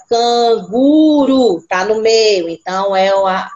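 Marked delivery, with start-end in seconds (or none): 0.1-1.6 put-on canguru dragged very slowly in syllables, a child's take; child sounding out. Teacher imitating her pupil, slowly syllabifying. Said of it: "aí ele vai".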